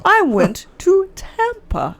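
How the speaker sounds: slow, high-pitched, word-by-word delivery; laboured, drawn-out saying of the sentence; slow demonstration. Shown as a demonstration. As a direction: stretch each word out carefully. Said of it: "if I say it fast".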